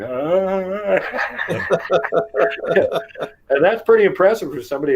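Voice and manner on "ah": high, drawn-out "ah"; open-mouthed rising cry of amazement; imitated jaw-dropped gasp. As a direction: mimic stunned awe with jaw hanging. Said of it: "jaw hanging, going".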